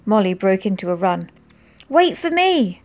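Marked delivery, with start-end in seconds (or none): 1.9-2.8 high call after someone running. A narrator's reading. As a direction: call out to them ahead. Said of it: "called Frank".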